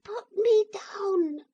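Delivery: very high, slow, tiny far-off voice; small piping voice from a toy; teeny tiny voice. A narrator's reading. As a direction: squeak it out like a tiny wooden figure. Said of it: "very teeny tiny voice said".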